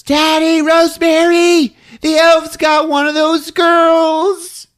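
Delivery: put-on a frightened kid calling to her parents; little girl. Judy waking her parents, high child voice.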